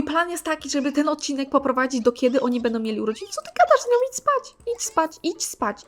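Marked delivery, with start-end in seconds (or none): 3.3-4.0 high, loud, fed-up shriek at the screen; exasperated yell. Real, not acted. Gamer shrieking at her Sim in disbelief. Player yelling at disobedient Sim. 4.1-5.2 high-pitched fed-up command; exasperated order. Real, not acted. Player ordering her Sim to go to sleep, high and fed up.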